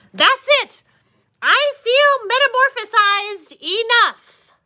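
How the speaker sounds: high, loud defiant announcement; fed-up declaration. Acted. Caterpillar loudly declares he's transformed enough. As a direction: declare you're done waiting.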